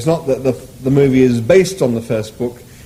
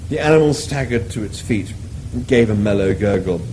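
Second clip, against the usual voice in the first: ordinary delivery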